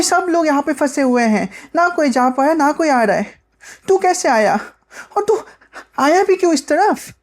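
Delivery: put-on high-pitched girl's voice, rising further into questions; stranded girl explaining, then questioning. Bina explains being stuck to Ronny, high girlish complaint. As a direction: explain in a high child voice, then challenge him.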